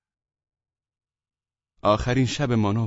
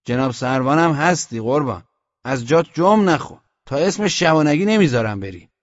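raised, louder refusal; stubborn sentry; 4 semitones higher, 6 dB louder than usual. A narrator's reading. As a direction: refuse your captain loudly. Sentry orders commander to stay put. (امتناع با صدای بلند)

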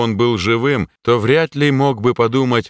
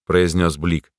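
plain reading voice

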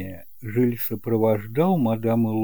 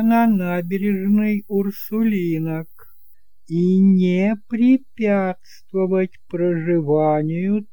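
higher, slow and deliberate reading of the will; bilious old notary; 7 semitones higher, 4 dB louder, slower than usual. Acted. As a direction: spell out the terms slowly like a sour old lawyer.

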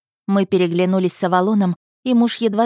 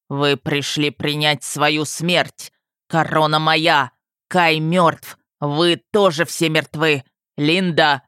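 slow, heavy dragon pronouncement; thunderous monster voice; 2 semitones lower, slower than usual. A narrator's reading. Dragon father menaces the attackers, thunderous monster voice. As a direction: make each slow word a monstrous death sentence. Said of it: "из его глотки раздался громоподобный голос".

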